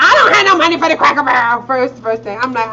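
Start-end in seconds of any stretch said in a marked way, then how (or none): 0.0-1.6 fast, louder, a hint of a cranky mom; grumbling mother. Mom refusing breakfast, fast and grumbly. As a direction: snap it out quick. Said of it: "mother's reply".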